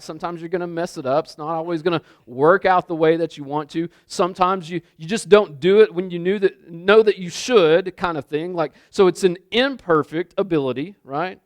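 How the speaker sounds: ordinary delivery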